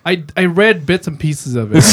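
usual speaking voice

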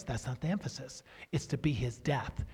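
ordinary talk

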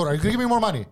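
high-pitched indignant demand; angry retort. Retold or imitated. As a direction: throw the question at the boss, heated. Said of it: "I was angry, bro".

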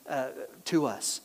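ordinary talk

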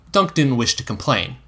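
plain reading voice

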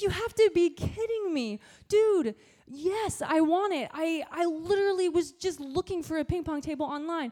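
reliving the shock of getting the offer; excited disbelief. Retold or imitated. Youth pastor retelling his thrilled reply to the pastor, excited.

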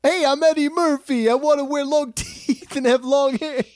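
high, loud hammed-up Eddie Murphy; imitating Eddie Murphy. Friend replays the bit, high loud hammed-up impression. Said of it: "you just went".

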